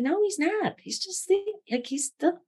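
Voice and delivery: high, pleading disbelief; a high, insistent no; pleading denial. Retold or imitated. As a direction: pitch it way up, refusing to accept it. Denying the dog has died.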